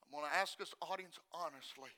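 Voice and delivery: low, very soft appeal to the audience; hushed preaching. Acted. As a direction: ask the congregation quietly and sincerely.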